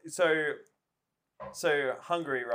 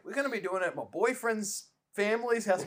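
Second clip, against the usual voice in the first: lightly voiced mum, higher pitched; imitated mum; 6 semitones higher than usual. Mum telling her kid the Christmas plan, lightly imitated. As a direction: put on a slightly different, higher voice for mum. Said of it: "She's like".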